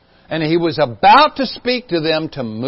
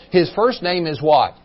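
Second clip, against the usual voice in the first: ordinary delivery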